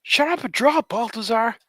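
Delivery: high, loud nagging character; mock scolding. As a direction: heckle in a high put-on voice. Acted voice scolding him to work.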